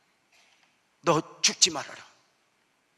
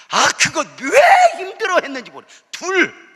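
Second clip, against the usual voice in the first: higher and louder, laughing through the words; laughing disbelief; 11 semitones higher, 12 dB louder than usual. Retold or imitated. Member recalls the first child, laughing at it.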